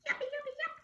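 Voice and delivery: very high, happy yipping; joyful yips. Acted. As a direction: squeal with doggy joy. Dog racing joyfully.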